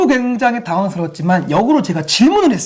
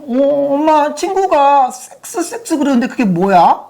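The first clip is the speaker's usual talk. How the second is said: put-on higher, innocent child voice; child's question; 7 semitones higher, slower than usual. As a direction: ask mom like a curious kid. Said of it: "우리 아이가". (엄마에게 묻는 아이 흉내, 높고 천진하게)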